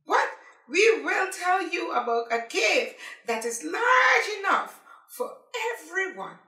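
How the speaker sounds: high, loud, eager chorus of little crabs; excited crabs. Acted. Crabs call out to Paddy, high and loud. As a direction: shout it out like thrilled small creatures. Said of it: "Then the crabs join in".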